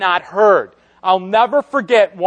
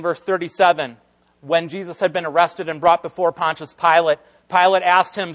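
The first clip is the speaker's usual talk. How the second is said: ordinary talk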